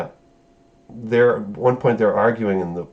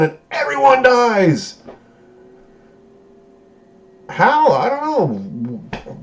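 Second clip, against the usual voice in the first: high, loud disbelieving outburst; exasperated rant; 13 semitones higher, 4 dB louder, slower than usual. Real, not acted. Fan ranting about the episode, loud exasperation. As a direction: throw up your hands at the plot.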